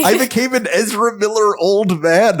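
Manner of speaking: put-on high quavery old man; shaky old voice. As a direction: groan it like a tired old man. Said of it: "doing the old man voice".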